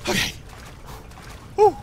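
normal talking voice

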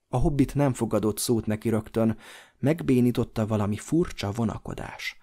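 plain reading voice

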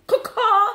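loud high bird caw; magpie caw. Pretend. Imitating a magpie.

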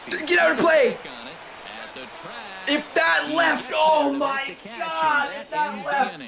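high, slow shouted plea; will the ball foul, yelling; urgent shout. Real, not acted. Player shouting at the ball to go foul.

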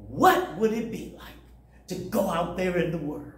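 ordinary talk, nothing marked

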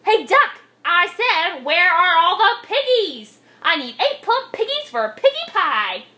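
high, loud demand; storybook witch hollering at an animal; shouting witch. A narrator's reading. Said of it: "Gritch shouted to a duck".

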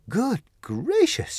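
high, slow gasp of amazement; stunned to land home; surprised cry. Acted. Dorothy lands on the prairie, high surprised cry. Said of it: "she cried".

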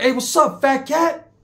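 high-pitched taunting greeting; calling out. Retold or imitated. Bakersfield inmate hails Danny Boy as he walks by, calling out. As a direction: sing out a hail to someone passing.

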